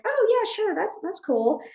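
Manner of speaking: high-pitched, put-on nonchalance; acting cool. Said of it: "I'm trying to act cool".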